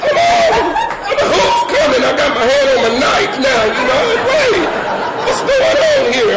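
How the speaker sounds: very high, squealed alarm; squealing as if danger is at the door; paranoid panic. Acted. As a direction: play a jumpy, high-pitched comic panic. Acting out his mounting paranoia.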